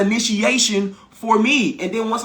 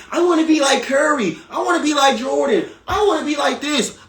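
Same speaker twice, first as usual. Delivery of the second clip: eager copycat; 7 semitones higher than usual. Retold or imitated. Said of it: "everybody will say".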